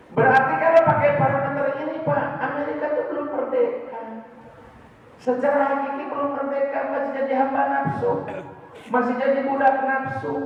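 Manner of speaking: high, loud, driving sermon voice; fiery preaching. Acted. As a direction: hammer the point home to the crowd. Preaching at full force. (berkhotbah berapi-api, tinggi dan keras)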